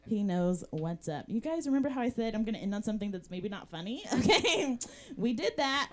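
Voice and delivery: normal talking voice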